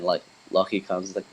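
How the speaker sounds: usual speaking voice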